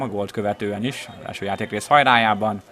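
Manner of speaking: ordinary talk, nothing marked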